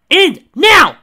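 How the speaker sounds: very high, loud screamed command. Acted. Girl in the van screaming at the agent to get in. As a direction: scream it urgently. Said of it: "a girl screamed".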